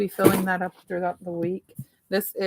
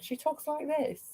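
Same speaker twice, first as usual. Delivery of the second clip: put-on a light stab at an English accent, pitched higher; English accent impression; 7 semitones higher, 9 dB quieter than usual. Mimicking how her English viewer talks, English accent. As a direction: try a quick English accent, half-hearted. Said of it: "She's from, like, England".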